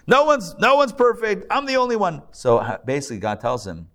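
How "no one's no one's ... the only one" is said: high, indignant insistence; self-righteous prophet. Retold or imitated. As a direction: insist you alone are perfect.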